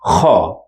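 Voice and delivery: kha rasped from the back of the throat; like a snore, but from the throat; throat kha sound. Shown as a demonstration. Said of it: "like snoring sound".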